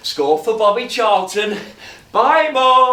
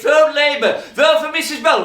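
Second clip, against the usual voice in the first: loudspeaker call; 8 semitones higher, 7 dB louder than usual. Retold or imitated. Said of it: "Driving a loudspeaker up and down".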